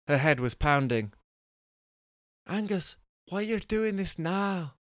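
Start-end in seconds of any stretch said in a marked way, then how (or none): 2.5-4.8 high, slow Bristolian woman's voice, not quite landing; shaky grasp of a Bristolian women's accent; attempted Bristolian woman. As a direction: try a Bristolian wife complaining. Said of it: "difficult for me to do a Bristolian women's accent".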